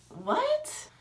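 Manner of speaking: very high-pitched stunned squeak; acted disbelief. Reacting to friend's bad news, high incredulous squeak. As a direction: re-enact shock, voice shooting up.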